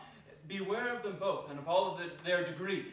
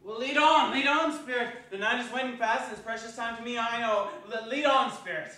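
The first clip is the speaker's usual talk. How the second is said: higher and louder, pressing urgently; eager urging; 5 semitones higher, 6 dB louder than usual. Acted. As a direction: hurry the silent spirit along. Scrooge urging the phantom on.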